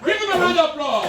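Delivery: high, loud shout to the crowd; rally the audience at full voice; big stage call over the noise; calling for applause. Real, not acted.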